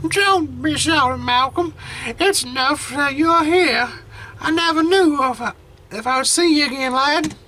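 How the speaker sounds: put-on a frail old grandmother, tender and teary; grandma voice. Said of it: "I lost the grandma voice".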